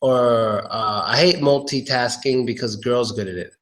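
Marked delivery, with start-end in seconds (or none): 1.4-2.4 "multitasking" as mul-TEE-tasking; TEE variant. Shown as a demonstration. Teacher gives example sentence, multitasking with TEE. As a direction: say "multitasking" with "ee" in the middle. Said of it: "many different ways to say".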